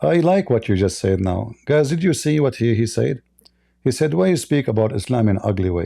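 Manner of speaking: normal talking voice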